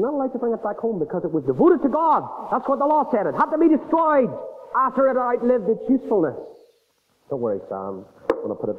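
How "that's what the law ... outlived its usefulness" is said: ordinary delivery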